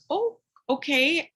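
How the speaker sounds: slow, rising, drawn-out oh okay; a mildly stunned oh okay that lifts at the end; taken aback. Retold or imitated. Retelling her reaction to the mom's flat refusal, drawn out and taken aback. Mom flatly refuses to mask kids.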